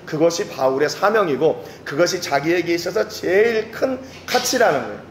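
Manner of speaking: ordinary talk, nothing marked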